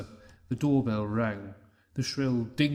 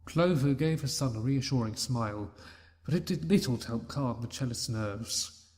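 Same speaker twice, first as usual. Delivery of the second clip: ordinary delivery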